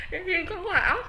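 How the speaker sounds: laughing. Real, not acted. Still laughing after the joke.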